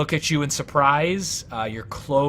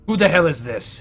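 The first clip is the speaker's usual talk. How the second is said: gruff demand; 4 semitones higher, 7 dB louder than usual. Acted. Half-orc walks in demanding.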